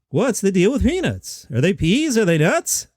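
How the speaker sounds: high, sing-song stand-up questions; half-ass Jerry Seinfeld. Shown as a demonstration. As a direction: pitch it up and lilt each question. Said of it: "everybody does that sing-song Jerry".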